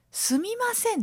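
high, apologetic すみません; typical sumimasen. Retold or imitated. Nurse calling to a patient, high apologetic すみません. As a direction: chirp すみません up high and timid. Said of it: "って言ってないですか".

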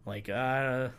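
brief movie-star swagger; a quick cocky action-hero voice; Johnny Cage impression. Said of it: "that's my Johnny Cage impression".

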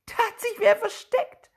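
high, loud frightened shout; boy finding someone hidden in the mill, screaming in fright. A narrator's reading. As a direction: scream it out in a panic. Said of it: "Gleich darauf schreit er".

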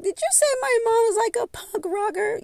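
high, far put-on girl voice; upset girl. The girl confronting the speaker, in a high hurt voice. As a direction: ask it like a wounded kid. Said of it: "she was like".

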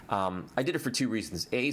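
ordinary delivery

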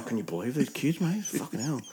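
hushed grumble, well under normal volume; disapproving aside. Retold or imitated. Older beachgoer privately complaining about the kids, hushed disapproval. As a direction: grumble it low-volume to a mate on the side.

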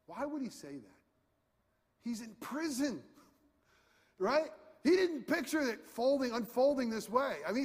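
normal talking voice

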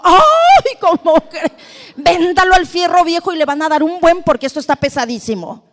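put-on shrieking, high, loud indignant old lady, laughing; loud and high with a laugh, outraged at selling it; mock indignant lady. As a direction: play the scandalised lady. Lady reacting outraged, voiced.

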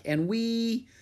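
"and we," pitched high and left hanging; trailing pause. A narrator's reading. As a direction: hold "and we," unfinished, unsure how to continue. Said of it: "he paused".

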